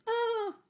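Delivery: very high, short yelp of fright; boy gasping at a scary surprise; startled cry. Retold or imitated. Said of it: "He's like".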